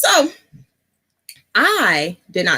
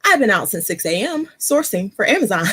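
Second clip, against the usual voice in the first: normal talking voice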